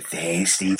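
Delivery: high, soft Scouse echo; Liverpool accent. Retold or imitated. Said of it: "Is that your Liverpool accent?".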